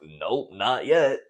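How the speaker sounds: slow, drawn-out cheeky nope; cheeky reply. Retold or imitated. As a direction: tease her with a slow, smug no. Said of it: "And I was like".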